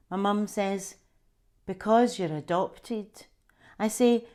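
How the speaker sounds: an author reading her memoir aloud; story narration. Acted. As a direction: tell the childhood scene as a gentle story reading. Reads her memoir extract aloud.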